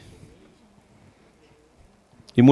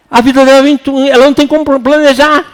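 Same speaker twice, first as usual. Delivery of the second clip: ordinary talk, nothing marked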